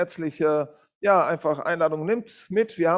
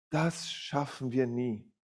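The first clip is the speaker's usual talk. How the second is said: slow, softer, worn-out huff; panting; 4 semitones lower, 8 dB quieter, slower than usual. A narrator's reading. Exhausted man gasps to his helper, slow and soft. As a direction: puff it out, spent and defeated. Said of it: "schnaufte der Mann".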